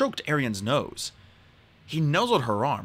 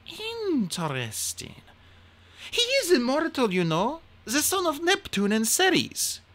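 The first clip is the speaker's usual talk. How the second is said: a higher, slow and thoughtful queen; a queen intrigued and lecturing; the queen musing aloud to Hazel about the horse; 13 semitones higher than usual. Acted.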